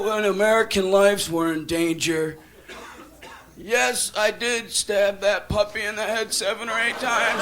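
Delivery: low, solemn mock testimony; Oliver North. Witness testifying to Congress, low and solemn. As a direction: confess slowly with humble gravity. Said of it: "And he go".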